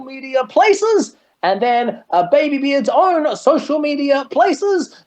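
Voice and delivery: high, bright-pushed promoter pitch, selling the product; promo mode voice. Shown as a demonstration. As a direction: hype up the group like an ad read. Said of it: "Can you hear how much my voice changes when I go into promote the product mode?".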